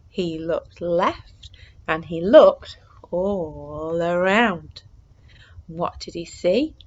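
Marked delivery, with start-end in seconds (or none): none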